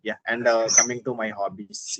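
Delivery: ordinary talk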